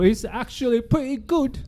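high-pitched fake English accent; mock British accent. Host insists it's good, mock British accent.

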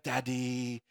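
lightly voiced toddler, hushed; soft little toddler. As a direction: coo it like a baby on your shoulder. Toddler voiced snuggling on lap.

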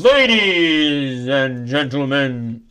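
slow, loud drawn-out announcement; announcer voice. Acted. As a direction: announce it like a game show host. Host announcing segment.

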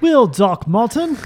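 put-on loud, high, drawn-out British accent; English accent. Friend playing an English Doc Martens seller, exaggerated English accent. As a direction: ham up an English street accent slowly. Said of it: "Let's hear your English accent".

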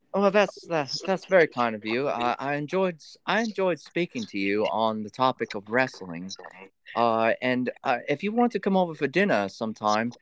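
polite put-on English accent; amateur British accent demo. Shown as a demonstration. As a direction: play a courteous Brit with an English accent. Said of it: "Let's hear your, uh, English accent".

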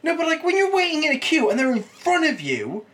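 high excited outburst. Real, not acted. High excited outburst about queues.

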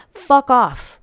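a sharp, loud comeback; angry retort. Real, not acted. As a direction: spit it back at them. Retort to mocked advice.

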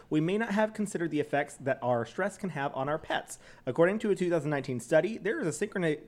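even, scripted sponsor read. Real, not acted. Co-host reads dog CBD sponsor copy, announcer-like. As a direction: read the copy clearly and steadily.

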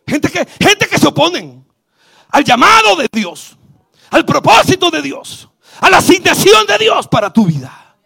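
climbing to a loud, high shout; building preaching. Real, not acted. Pastor rallying the church, building to a loud high shout. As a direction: preach it harder each phrase. Preaching at full force, rising.

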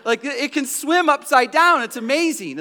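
high, loud, hammed-up delight; dad mock-cheerfully showing off a dying fish; mock excited. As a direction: pretend to be thrilled, showing the kids. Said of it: "I'm like".

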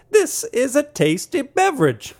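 high slow sommelier-style pronouncement; mock fancy beer-critic voice; hammed-up praise. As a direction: stretch it out grandly, up high.

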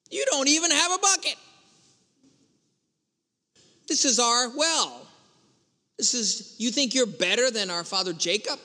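high, quick sassy start, then settling; a little sassy. Retold or imitated. Samaritan woman challenges Jesus at the well, a little sassy. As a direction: imitate a woman giving a bit of sass. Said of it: "Then she gets a little sassy".

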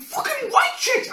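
high, loud laughing yell; laughing shout. Retold or imitated. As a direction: shout it in disbelief. Shouting in disbelief.

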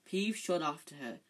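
ordinary delivery, nothing marked

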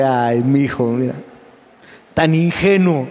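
amused God. Acted. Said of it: "Y Dios se ríe y dice".

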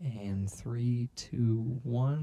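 whisper. Real, not acted. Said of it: "counting down in whisper".

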